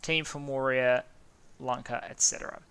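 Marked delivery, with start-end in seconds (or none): none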